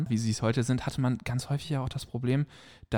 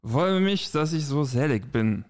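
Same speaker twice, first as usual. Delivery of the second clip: put-on slow, slurred drunkard, pitched up; drunken slurring; slower than usual. Shown as a demonstration. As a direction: slur the words like a happy drunk. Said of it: "Mal gucken, wie gut ich lallen kann".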